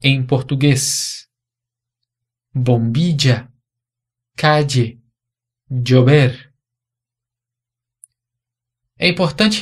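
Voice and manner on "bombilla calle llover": Bombilla, calle, llover with the ll as "dj"; teacher demonstrating Mexican dj ll in Bombilla, calle, llover. Shown as a demonstration. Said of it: "seria como D J em português".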